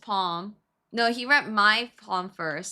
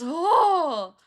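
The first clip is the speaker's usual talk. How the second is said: loud, high mock "Oh!"; hammed-up awe, high and loud; impressed exclamation; 7 semitones higher, 8 dB louder than usual. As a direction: act out a fortune teller's amazement. Palm reader reacting to C T's hand.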